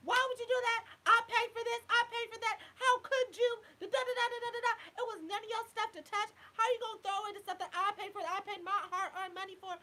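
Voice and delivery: hammed-up furious rant at the stepmom; retold outraged rant. Girl yelling at stepmom over thrown-out things, high mock outrage. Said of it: "But I was like".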